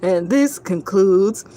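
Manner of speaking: slow, low, fading sign-off; broadcast sign-off. Acted. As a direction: sign off slowly like a TV station.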